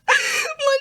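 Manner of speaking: very high squealing laugh; helpless laugh. Real, not acted. Cracking up at own Scottish rage, helpless laugh. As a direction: lose it laughing.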